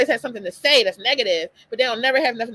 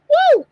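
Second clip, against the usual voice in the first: woo to shake it off; 10 dB louder than usual. Real, not acted. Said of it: "getting a little too emotional".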